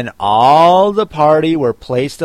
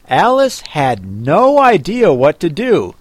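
usual speaking voice

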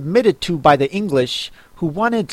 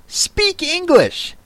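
high, slow squeaky bird voice; eaglet voice; slower than usual. Acted. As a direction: squawk it slowly, much higher. Eaglet retorts in character voice.

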